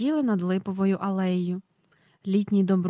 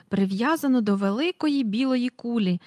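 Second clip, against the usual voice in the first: ordinary delivery, nothing marked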